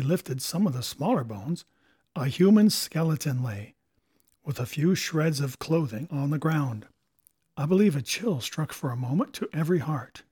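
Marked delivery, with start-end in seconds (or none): none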